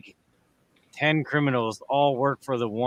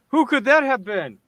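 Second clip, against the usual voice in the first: high, loud mock-naive question; high mock-naive sarcasm; sarcastic mock cluelessness; 8 semitones higher, 5 dB louder than usual. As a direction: play dumb sarcastically.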